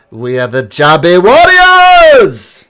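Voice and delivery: proud slow battle cry; calling out. Acted. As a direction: shout the team name proudly. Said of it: "calls out to the crowds".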